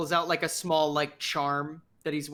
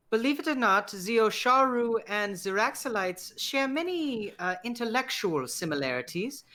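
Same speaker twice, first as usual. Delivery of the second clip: higher-pitched, keen scholarly character voice; eccentric scholar; 7 semitones higher than usual. Acted. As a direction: play an eager, mannered academic.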